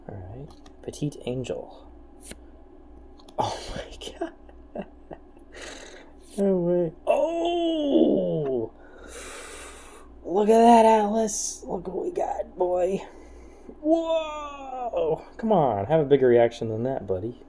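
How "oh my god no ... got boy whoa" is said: high, loud excited yelling; excited shout. Real, not acted. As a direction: lose it over the pull. Big pull, Dark Magician.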